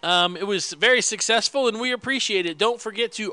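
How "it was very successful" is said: ordinary delivery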